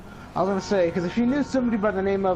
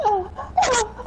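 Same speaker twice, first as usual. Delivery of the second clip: high, hammed-up fake moans; mock moaning. Chloe mocking his moan with high fake moans. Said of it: "And she goes".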